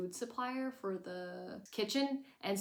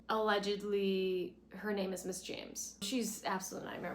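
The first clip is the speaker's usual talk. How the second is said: normal talking voice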